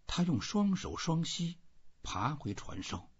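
plain reading voice

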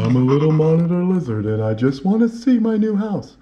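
playful little monitor lizard voice; put-on talking-lizard voice; Bobby Rubino. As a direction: talk as an eager pet lizard seeing his new home. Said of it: "this is my voice. This is how I sound".

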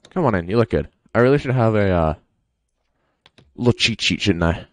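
normal talking voice

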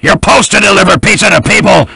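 loud angry shout, firing him on the spot; furious yelling. Acted. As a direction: bellow at the employee who ate the pizzas. Boss fires Scout in anger.

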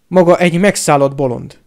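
loud accusation; angry shouting. A narrator's reading. Harry defying Piton to his face, angry shouting. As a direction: shout it straight at the professor. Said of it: "kiabálta Harry".

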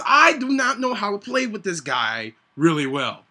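ordinary talk, nothing marked

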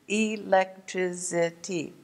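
"Electricity" slowly split into e, lec, tri, ci, ty; syllable by syllable. Shown as a demonstration. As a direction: count out "Electricity" in five slow beats. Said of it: "Five syllables in one word".

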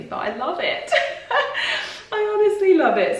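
high, giggly burst of enthusiasm; laughing delight. Real, not acted. Vlogger defending her old-woman dress style, laughing delightedly. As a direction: break into laughter, pitch shooting up.

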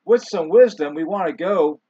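preacher's sudden loud declaration; rising preacher's proclamation; booming preacher. Real, not acted. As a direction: boom it out like from the pulpit. Preacher booms mid-sermon.

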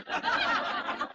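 breathy unvoiced laugh; airy laugh. Real, not acted. Speaker laughing at his own sex joke, silent breathy laugh. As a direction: let a silent laugh escape.

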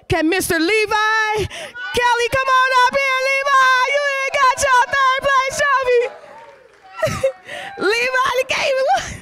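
high, excited calling out. Real, not acted. Host excitedly calls the new kid up for his trophy, high pitched. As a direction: call him up with delight.

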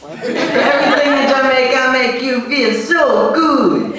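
high, drawn-out Jamaican lilt; Jamaican accent. Shown as a demonstration. Comic demonstrating the secret phrase, big Jamaican accent. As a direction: stretch it out in a hammed-up Jamaican accent. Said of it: "secret to speak a Jamaican accent".

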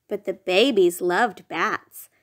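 ordinary delivery, nothing marked

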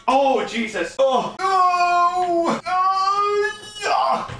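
high loud thrilled cries; excited shout. Real, not acted. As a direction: cheer as it lights up. Lamp plugged in, lights up.

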